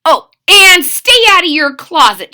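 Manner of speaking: loud high parting command; parting order. A narrator's reading. Mother warning her son as she leaves, loud. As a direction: call it back over your shoulder. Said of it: "she added".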